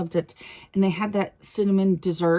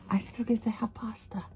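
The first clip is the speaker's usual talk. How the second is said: whisper; 8 dB quieter than usual. Real, not acted. Whispered delight.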